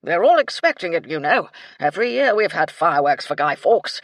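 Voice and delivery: put-on very loud, blustering old officer; insistent colonel. A narrator's reading. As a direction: bluff military gentleman pressing his point.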